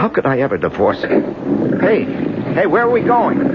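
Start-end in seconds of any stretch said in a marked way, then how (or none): 1.8-3.5 sudden high alarmed cry; jolted, calling out where; startled call. Acted. Man on a boat startled, calling out to Sally in alarm.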